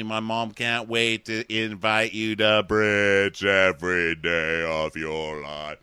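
slow, stretched-out mock sincerity; slow, syrupy send-up of a suitor; drawn-out mock gushing. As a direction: drag every word out to the end. Acting out a gushing suitor's speech.

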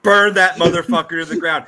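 loud, fiery mock rage; shaking with anger. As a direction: roar it like a furious fan. Mock outrage at rival jersey.

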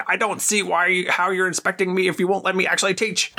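high, fast indignant teacher voice; lightly put-on Scottish teacher; mock McGonagall, high and fast. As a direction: play the teacher rattling it off high. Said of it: "she's like".

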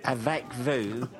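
a hammed-up Brit trying French, laughing; laughing mock tourist French. Brit in France asking for milk, mock French accent. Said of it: "you just go up to somebody and go".

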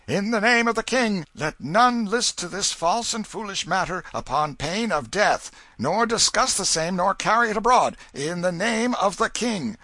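high, loud herald's cry; solemn proclamation. A narrator's reading. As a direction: proclaim it like a royal official. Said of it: "making solemn proclamation".

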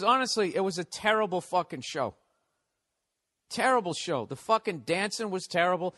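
ordinary delivery

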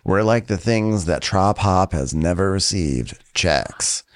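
low, slow laid-back mock voice; mocking Craig. Craig reassuring Shep, low slow mock bro. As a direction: drawl it low like a chill bro.

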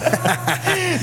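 high squealing burst of laughter; a sudden shrieking laugh. Real, not acted. Friend laughing at an insult.

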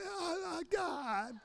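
husband groaning to God in the store, high and soft; mock exasperated groan. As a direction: squeeze it out high and breathy with dismay.

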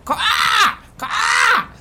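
loud high screeching caw; cawing raven. Acted. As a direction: shriek like an agitated crow. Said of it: "starts cawing at you crazily".

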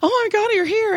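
high, overwrought gushing; mock a client nearly hyperventilating with relief; imitated frantic relief. Late-waiting client greets therapist at the door, imitated frantic gushing. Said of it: "She's almost hyperventilating".

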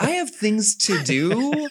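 high, slow, drawn-out excuse; mock brush-off. Player turning down the returning love interest, high drawn-out brush-off. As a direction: stretch it out high as a mock refusal. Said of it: "I'm like".

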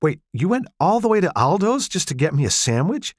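high and bright, delighted disbelief; a happy gasp of a question; surprised delight. Acted. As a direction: she can't believe he did this. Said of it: "Her frown was replaced by surprised delight".

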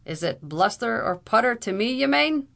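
loud Irish challenge, rising high; indignant cry. A narrator's reading. Captain challenging the lawyer's rebuke, indignant cry. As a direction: throw it back loudly, soaring at the end. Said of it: "cried Captain O'Blunderbuss".

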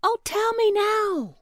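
high, eager storybook plea; pumpkin crying out. A narrator's reading. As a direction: plead in a small high voice. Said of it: "cried the little pumpkin".

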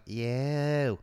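lighter drawn-out echo of the yell; children echoing teacher. Acted. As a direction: repeat YOU back like a class. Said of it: "And they're like".

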